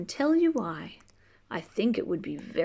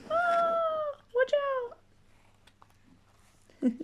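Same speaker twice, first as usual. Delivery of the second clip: a sudden very high playful boo; mock monster scare; slower than usual. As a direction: spook the kids for fun. Playing the scary creature.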